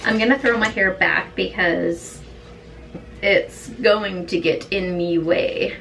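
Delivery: normal talking voice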